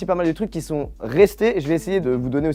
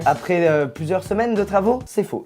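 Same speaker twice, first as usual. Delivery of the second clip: ordinary delivery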